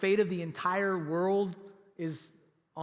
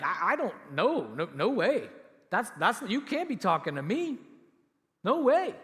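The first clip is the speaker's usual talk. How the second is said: stammering refusal that climbs to a higher, louder no way; flustered denial; 3 semitones higher, 6 dB louder than usual. Retold or imitated. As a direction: stumble over the words, then blurt it out. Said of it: "He's like".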